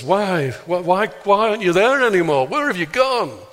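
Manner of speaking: high, loud, pleading why; anguished cry. Acted. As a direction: cry out to an absent God. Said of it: "a screaming Jesus".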